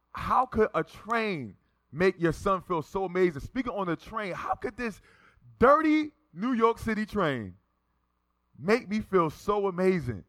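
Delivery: high, drawn-out amazement; re-enacted giddy disbelief, slow emphatic words; elated wonder. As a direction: marvel aloud to God, pitch climbing. Elated marvelling to God.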